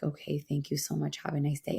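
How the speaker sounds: softly mimed, tightly held-in thanks; retold breath-held politeness. Customer holding back tears thanks the clerk, quiet held-breath politeness. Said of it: "I just hold my breath".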